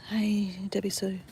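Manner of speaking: normal talking voice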